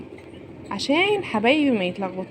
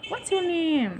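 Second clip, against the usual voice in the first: WHAT'S YOUR NAME run together, pitched high; quicker lilting question; 7 semitones higher, much slower than usual. Shown as a demonstration. Teacher models the girls' first meeting, high connected question.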